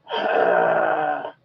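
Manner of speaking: deep rough growl at the dog; a low animal growl. Retold or imitated. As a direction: growl to scare her inside. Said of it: "Was Mama just growling?".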